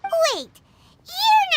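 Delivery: high, loud sudden "Wait"; startled realization called out; sudden shout. Acted. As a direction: stop them short with a shout. Sudden suspicion.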